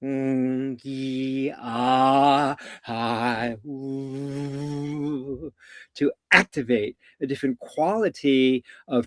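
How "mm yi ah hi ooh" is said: sustained vowel tones, hummed and held; steady vocal-exercise hum and open vowels; resonance tones. Shown as a demonstration. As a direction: move each held sound to another place in the body. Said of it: "resonance of reverberation to reside".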